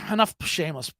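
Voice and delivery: ordinary talk, nothing marked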